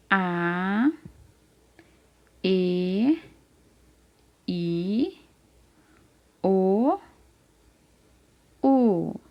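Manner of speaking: "A, E, I, O, U", each held long with a wait after it; repeat-after-me vowels. Shown as a demonstration. Teacher asking the child to repeat along, vowels stretched one by one. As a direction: draw out each vowel and leave room to echo it. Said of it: "Repete comigo".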